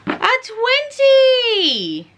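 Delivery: slow drawn build into a high, loud squeal; thrilled build-up. Real, not acted. Booktuber rolling a natural twenty, ecstatic. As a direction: build slowly then explode on twenty.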